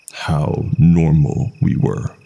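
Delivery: ordinary delivery, nothing marked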